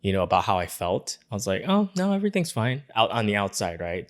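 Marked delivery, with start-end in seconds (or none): 1.7-2.8 higher-pitched mock-breezy denial; feigned all fine. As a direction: brush it off in a put-on lighter voice. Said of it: "a little bit in denial".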